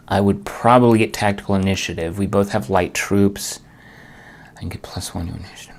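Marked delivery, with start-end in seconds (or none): 4.6-5.8 soft, fast whisper to himself; muttering a thought under his breath; whispered aside. Real, not acted. Muttering to himself over the board.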